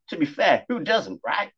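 a hint of a chatty cat; talking cat voice. Cat jokes.

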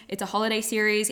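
ordinary talk, nothing marked

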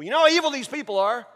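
Aaron. Acted. Aaron blaming the people, defensive. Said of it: "What does Aaron say?".

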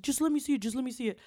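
high-pitched imitation of a pleading boyfriend; mock pleading, repeated insistently. Voicing boyfriend insisting to look.

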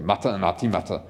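low-pitched Scottish Scotty voice; Scottish accent. Acted. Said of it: "say that in a Scottish voice".

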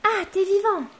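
high, loud burst of relief; excited relief. Acted. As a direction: cry out with joy. Marwa sees Joko awake.

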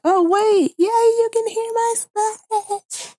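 squeaky high voice, slow and drawn out; high-pitched squeaky voice. Retold or imitated. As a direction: pitch way up and stretch it out. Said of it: "I was thinking".

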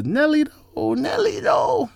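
very high, drawn-out falsetto; playful exclamation. Pretend. Host reacting to [name], playful falsetto. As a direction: squeal it, stretching the last word.

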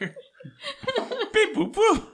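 very high laughing beep; robot computer noises. Pretend.

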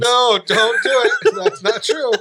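loud, high protest, drawn out; mock-outraged, laughing objection; laughing denial. Real, not acted. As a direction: deny the teasing while cracking up. Laughing denial of friend's teasing accusation.

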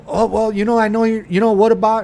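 imitating an overeager car salesman; pushy salesman. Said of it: "But don't be".

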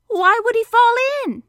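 high, loud questioning; puzzled Piglet. A narrator's reading. Piglet doubting Pooh's trap plan, high insistent question.

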